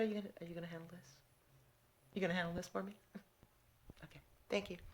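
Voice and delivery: hushed aside to a coworker; quiet aside under the breath; whispered aside. Real, not acted.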